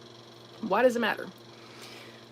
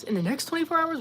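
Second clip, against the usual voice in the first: much higher, incredulous "twenty-four hours"; surprised question; 8 semitones higher, faster than usual. Real, not acted. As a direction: question it in disbelief. Surprised reaction to chat claim.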